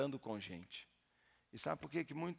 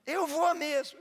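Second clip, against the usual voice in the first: much higher, louder demon threat; demon boast; 12 dB louder than usual. Retold or imitated. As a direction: bark it as the demon. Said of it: "Aí o demônio".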